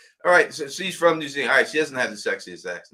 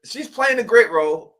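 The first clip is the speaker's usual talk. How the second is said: much higher and louder, gushing over the role; excited gush; 11 semitones higher, 7 dB louder than usual. Real, not acted. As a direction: rave about it, pitch way up. Gushing about Van's role.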